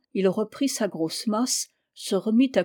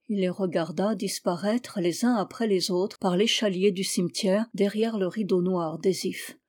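usual speaking voice

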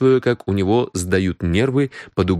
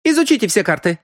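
very high, urgent woman's voice; accusing woman. Acted. Аврора urges them to study the cards, accusing.

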